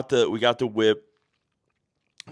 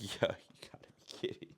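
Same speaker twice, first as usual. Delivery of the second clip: stunned whisper; 11 semitones higher, 8 dB quieter than usual. Retold or imitated. Shock at hearing Dyer.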